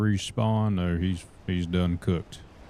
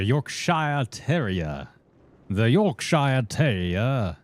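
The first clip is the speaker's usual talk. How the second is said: slow, higher-pitched fake British accent; mock British accent; 4 semitones higher, slower than usual. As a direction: draw it out in a put-on British accent. Mimicking British accent on dog breed.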